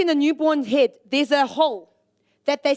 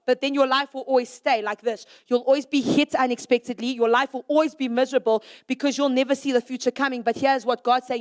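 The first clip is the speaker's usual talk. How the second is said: usual speaking voice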